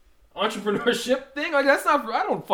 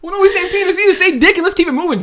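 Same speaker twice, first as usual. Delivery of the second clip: loud objection; 6 semitones higher, 8 dB louder than usual. Real, not acted. Friend objects to word choice.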